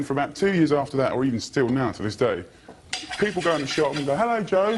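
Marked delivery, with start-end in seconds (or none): 4.1-4.7 high-pitched mock customer hello; cheery greeting. Shop customers greeting George by mistake, cheery. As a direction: sing out a friendly greeting, much higher.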